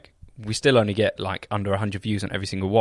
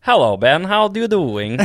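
sing-song, rising Swedish greeting; Swedish accent; 5 semitones higher, 4 dB louder than usual. Shown as a demonstration. Host revives his old routine, Swedish accent. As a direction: greet in a lilting mock Swedish voice. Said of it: "I love your Swedish one".

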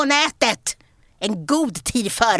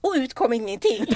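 ordinary talk

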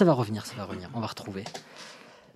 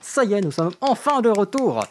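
high, loud host welcome; bright podcast intro; 11 semitones higher, 8 dB louder than usual. Acted. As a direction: open the show with energy.

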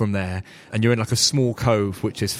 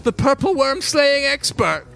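NPC voice; 4 dB louder than usual. Acted. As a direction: announce it like a proud herald. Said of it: "And she goes".

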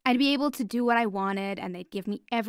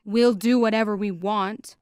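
harsh reply; 6 dB louder than usual. Acted. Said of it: "one of the men replied harshly".

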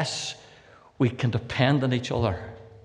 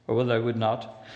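raised, measured scripture reading voice; King James reading; 4 semitones higher than usual. Acted. Preacher reading John's gospel to the congregation, solemn. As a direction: intone the old text with weight.

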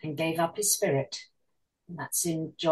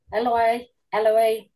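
raised, measured scriptural cry; loud cry; 2 semitones higher, 6 dB louder than usual. A narrator's reading. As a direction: read it as a restrained cry, louder on the name. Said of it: "cried in a loud voice".

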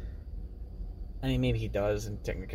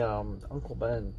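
whisper; 3 semitones lower, 7 dB quieter than usual. Real, not acted. Whispered mention of sad scene.

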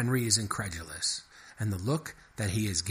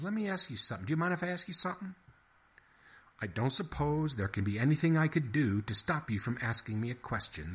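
higher, fast mouse asking; pushy little mouse; eager mouse, quick; 7 semitones higher, faster than usual. A narrator's reading. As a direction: rattle off the question like a nosy mouse. Henry then guard.

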